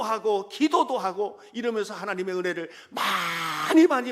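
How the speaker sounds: loud, high, ringing sermon climax; fervent, loud pulpit preaching; full-force preaching. Real, not acted. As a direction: preach it out to the whole congregation.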